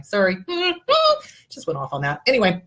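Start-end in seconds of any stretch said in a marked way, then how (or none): 0.4-1.2 sheepish laugh. Real, not acted. Laughs at her own rant.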